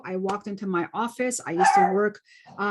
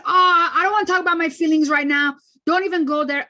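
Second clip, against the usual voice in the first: irritated refusal; 5 semitones higher, 6 dB louder than usual. Retold or imitated. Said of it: "And I said".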